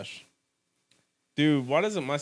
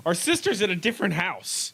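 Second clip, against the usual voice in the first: high comic protest; mock exasperated sibling; 7 semitones higher, 4 dB louder than usual. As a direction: protest like an aggrieved kid, pitched up. Plays siblings protesting at breakfast.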